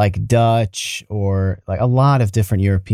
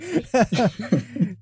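soft laughter; 12 semitones higher, 7 dB quieter than usual. Real, not acted. Laughing at shared joke.

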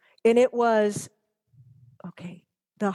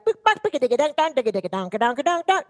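scat jingle. Retold or imitated. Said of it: "on the old one would be like".

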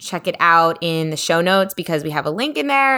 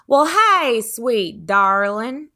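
put-on high, drawn-out sweet old man greeting; grandpa's greeting; 6 semitones higher, much slower than usual. Granddaughter imitating her grandpa's doting hello. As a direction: coo it slowly like a doting grandpa. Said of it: "that's exactly how he talks".

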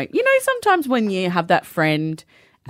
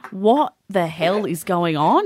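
ordinary delivery

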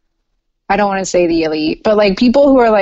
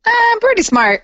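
mock boast; 14 semitones higher than usual. Real, not acted. Playful boast contest.

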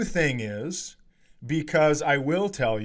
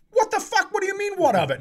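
losing it; faster than usual. Retold or imitated. Shouting at the driver who flipped him off, furious and high. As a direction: blow up at the rude driver. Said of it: "Now I lose my mind".

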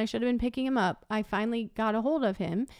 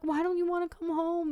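higher-pitched, mildly pleading retelling; retold tearful plea; 6 semitones higher than usual. Crying mother asks her son why he won't come home, retold tearful plea. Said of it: "I was just crying so hard".

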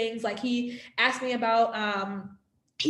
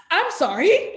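high, louder rising sorry in full disbelief; stunned I'm sorry to the doctor, pitched way up; outraged disbelief; 7 semitones higher, 6 dB louder, slower than usual. Retold or imitated. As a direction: hit sorry up high like you can't believe your ears. Incredulous at doctor's questions.

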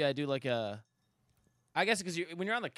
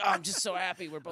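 raised, hammed-up contented sigh; exhausted by happiness; 5 semitones higher, 4 dB louder than usual. Shown as a demonstration. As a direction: act overcome by happiness with your partner. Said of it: "When I do it, I'm like".

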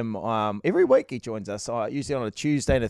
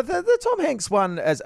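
big build-up, pitch up high; oversold hype; 12 semitones higher than usual. Shown as a demonstration. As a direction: hype the name up dramatically. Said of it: "I oversold it".

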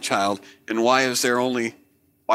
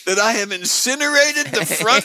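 mock preacher; 11 semitones higher than usual.

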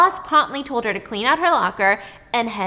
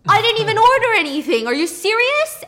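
much higher, louder disbelief; outraged; 11 semitones higher, 8 dB louder than usual. Retold or imitated. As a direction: explode in disbelief. Nick outraged at surprise check.

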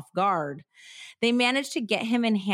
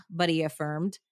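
ordinary talk, nothing marked

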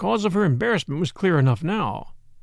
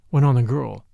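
ordinary delivery

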